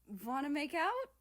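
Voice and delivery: bold blurt. Acted. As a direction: blurt it out bravely. South blurts bold question.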